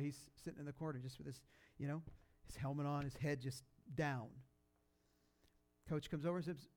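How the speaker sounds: normal talking voice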